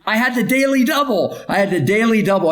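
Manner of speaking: high-pitched, thrilled bragging; excited boast. Real, not acted. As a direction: exclaim it proudly, pitch jumping up. Coach boasts about two star juniors.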